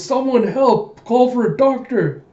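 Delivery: high, loud desperate cry; calling for help. Retold or imitated. Koyomi cradles her father, high desperate call for help.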